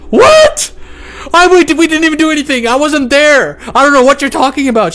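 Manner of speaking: high squealed disbelief, loud; frantic, high-pitched mock protest; shocked denial. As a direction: squawk it out in hammed-up shock. Acted shocked denial of accusation.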